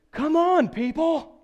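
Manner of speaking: put-on high, loud little kid's impatient call; imitating a four-year-old. Dad imitating his four-year-old in the car, high and loud. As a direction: mimic a small child huffing at strangers. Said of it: "I hear from the back seat, my four-year-old".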